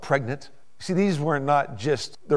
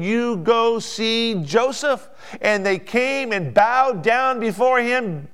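raised, ringing preacher's voice; full-force preaching; 8 semitones higher, 7 dB louder than usual. Acted. Preacher telling how Pharaoh sent everyone to Joseph, full-force preaching. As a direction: build to loud, high, stretched words.

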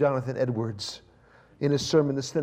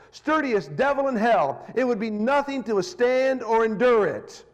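building from plain to high, loud preaching; fiery sermon reading; 8 semitones higher, 4 dB louder than usual. Acted. Preacher reading Edwards to the congregation with rising fire. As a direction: thunder the warning to the sinners.

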